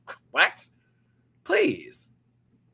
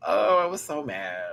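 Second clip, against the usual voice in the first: ordinary talk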